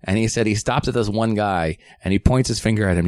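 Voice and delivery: ordinary delivery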